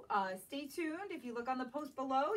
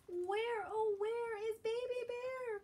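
high, carrying call for a lost cub; Mama Bear calling; 10 semitones higher, slower than usual. Acted. Mama Bear searching for her missing cub, calling high. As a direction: call out high across the woods. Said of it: "calls Mama".

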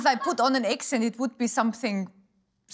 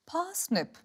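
parsnip in a British accent, pitched higher, softer, with a falling tune; British-sounding word; 6 semitones higher, 5 dB quieter than usual. Shown as a demonstration. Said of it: "makes me sound really British".

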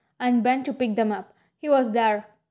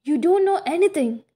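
higher, louder angry outburst; definitely mad; 6 semitones higher, 4 dB louder than usual. A narrator's reading. Edward firing back at Bella, openly angry and loud. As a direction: let him really lose his temper. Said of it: "He was definitely mad".

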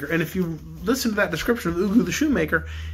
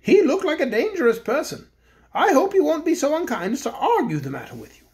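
higher-pitched thoughtful king voice; a toy bear king musing, pitched up; king warning Cake about the magician, higher voice; 9 semitones higher than usual. A narrator's reading.

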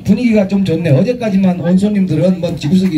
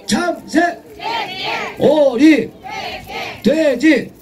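high shouted animal names; game calls; 11 semitones higher, much slower than usual. Acted. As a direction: fire off each call up high. Call and response game.

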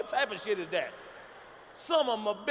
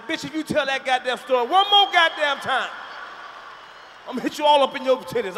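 louder, exasperated threat; fed-up threat; 2 semitones higher, 6 dB louder than usual. Acted. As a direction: cut her off, sick of it. Fed-up listener snaps at teller.

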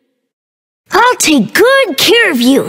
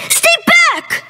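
scared warning; 9 semitones higher than usual. Acted. Child scared of a stranger.

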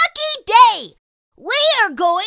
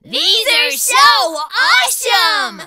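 thrilled; 6 semitones higher, 3 dB louder, slower than usual. Acted. Kid thrilled with gift.

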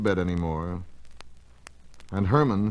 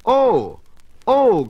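put-on much higher, louder little-girl cries of wonder; excited cries; 13 semitones higher, 7 dB louder than usual. A little girl sees a witch in the sky and cries out, thrilled. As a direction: gasp it out like a thrilled child. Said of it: "cried a little girl".

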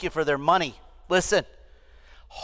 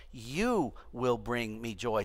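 slow, soft and lingering; a slow hushed promise; soft doting vow; 8 semitones lower, 10 dB quieter, much slower than usual. Retold or imitated. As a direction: coo it like a hopeful parent. Parents' vow to baby.

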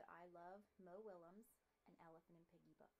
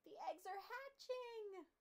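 excited; 11 semitones higher, 5 dB louder, slower than usual. Acted. Piggy announces the news.

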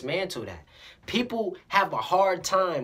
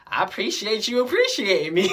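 higher, louder, breaking into laughter; imitated happiness; 4 semitones higher, 5 dB louder than usual. Smiling customer thanking the cashier back, all happy. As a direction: act out delighted thanks with a laugh. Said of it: "he's all happy and junk".

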